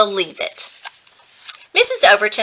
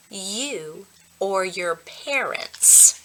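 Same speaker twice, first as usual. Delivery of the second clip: slow, much softer, pointed words; cold quiet challenge; 10 dB quieter, much slower than usual. Acted. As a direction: draw it out quietly, pressing him hard. Evan jealous, wants to shake Scott.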